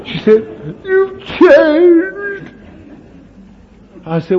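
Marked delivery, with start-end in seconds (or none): 0.8-2.5 much higher, crying woman's voice; young woman telling the preacher he has changed, in tears; tearful young woman. Retold or imitated. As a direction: let the tears choke it as the young lady.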